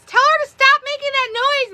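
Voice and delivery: high squeaky mock voice of complaining classmates; mock whiny kids. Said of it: "they'd be like".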